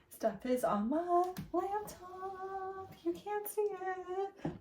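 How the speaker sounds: high, slow, hushed playful voice; slow high teasing murmur, half-hushed; mock eerie hush. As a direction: say it like a spooky secret. Playful eerie aside about the file.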